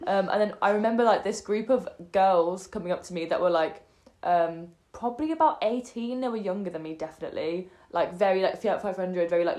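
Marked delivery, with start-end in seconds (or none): none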